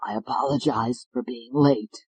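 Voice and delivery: hoarse croak, quiet. Acted. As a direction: croak it out, feverish. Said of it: "His voice was a croak".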